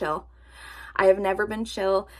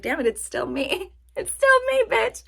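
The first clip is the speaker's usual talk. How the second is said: high laughing squeal; laughing disbelief; 12 semitones higher than usual. Retold or imitated. On the scale, telling viewers, laughing squeal. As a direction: laugh it out, mock dismay. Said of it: "I was like".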